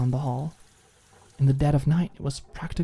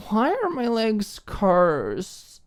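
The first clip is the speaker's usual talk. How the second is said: put-on high, slow, whiny drunk voice; tipsy girl whining about her legs; drunk pouting whine; 8 semitones higher, slower than usual. A narrator's reading. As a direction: pout and whine like a cursed victim. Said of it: "She pouted".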